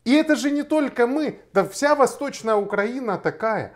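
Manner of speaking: ordinary talk, nothing marked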